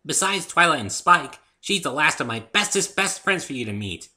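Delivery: high, loud bubbly pony; excited Pinkie; bouncy eager listing. A narrator's reading. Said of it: "Pinkie speaking".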